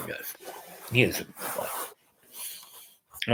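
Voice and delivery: slow whisper to the dog. Real, not acted. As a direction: breathe it slowly to the pet. Praising the dog.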